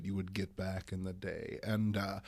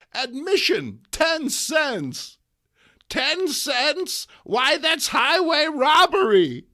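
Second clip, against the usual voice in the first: put-on much higher, louder indignant old woman; outraged old lady; 13 dB louder, slower than usual. Aunt May reading the toilet sign, high indignant old lady.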